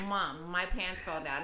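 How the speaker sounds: put-on slow flat kid voice; deadpan, no alarm at all; lightly voiced deadpan six-year-old; flat deadpan child. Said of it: "straight tone like that".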